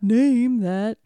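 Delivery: slow, loud, high game show call; announcer voice. Acted. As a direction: build it up like a host.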